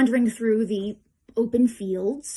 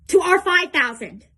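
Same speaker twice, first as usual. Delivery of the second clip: raised, high, forceful voice; commander's loud declaration; 7 semitones higher, 8 dB louder than usual. Acted. Commander comparing troop numbers before battle, loud declaration. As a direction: drive the number home to the troops.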